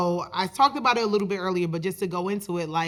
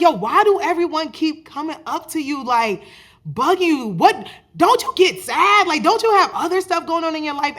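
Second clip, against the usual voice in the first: higher, louder voice of the friend, incredulous; imitating his friend; 8 semitones higher, 6 dB louder than usual. Friend questions why everyone keeps bugging him, higher mock voice. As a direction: put on the buddy's voice and sound baffled. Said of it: "He talks like this".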